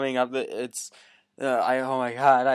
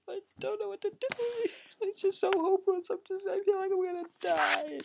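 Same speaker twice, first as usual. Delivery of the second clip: high, wailing hammed-up sob; mock crying. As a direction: fake grief over John. Grief over John's death.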